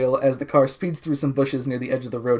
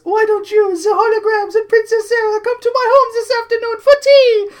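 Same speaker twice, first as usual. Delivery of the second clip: very high, loud, hammed-up Countess; shrieky falsetto society hostess; 7 dB louder than usual. Countess inviting guests to tea, high loud put-on falsetto. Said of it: "says the Countess".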